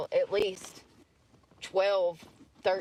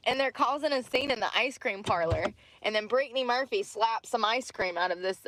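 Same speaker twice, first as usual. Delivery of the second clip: ordinary talk, nothing marked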